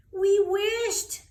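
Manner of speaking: breathless, loud, high little cry; amazed child. Acted. Lucy gasping to the candy man, amazed and loud. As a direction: she can't believe the wish came true.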